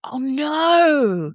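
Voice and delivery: long rising-then-falling groan of sympathy; dismayed reaction. Real, not acted. Listener hearing of the duet disaster, amused dismay.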